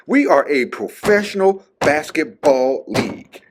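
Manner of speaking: high, emphatic, desk-pounding quote; imitating Isaiah Thomas. Vlogger quoting Isaiah Thomas pounding the desk, indignant. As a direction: mimic the indignant player hammering his point. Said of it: "pounded his hands on the desk like".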